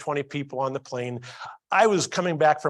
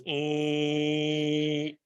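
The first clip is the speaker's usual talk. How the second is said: long, level mechanical "ee"; sustained monotone whirr; lid opening sound. Retold or imitated. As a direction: imitate a slow motorized lid. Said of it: "the lid goes".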